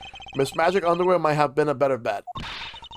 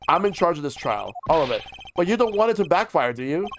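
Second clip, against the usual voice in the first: usual speaking voice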